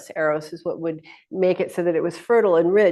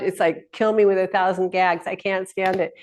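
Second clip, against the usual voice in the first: ordinary talk, nothing marked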